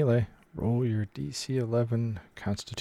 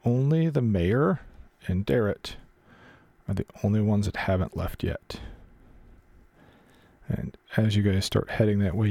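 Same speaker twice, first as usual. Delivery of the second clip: ordinary talk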